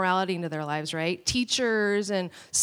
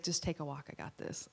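low, much softer, soothing; hushed dad taking over; calm quiet husband; 6 semitones lower, 13 dB quieter than usual. Retold or imitated. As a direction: settle her down gently. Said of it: "and he's like".

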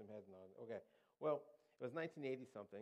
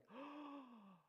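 high, drawn-out gasp of alarm; exaggerated worried gasp; mock gasp; 14 semitones higher, 7 dB quieter than usual. Shown as a demonstration. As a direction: suck in a fussing, over-concerned breath. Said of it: "no, like, none of that".

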